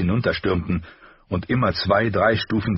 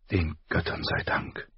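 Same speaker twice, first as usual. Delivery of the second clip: softer, whispered relief; breathed whisper; 6 dB quieter than usual. A narrator's reading. Caitlin whispers her thanks to the gods. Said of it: "hauchte sie".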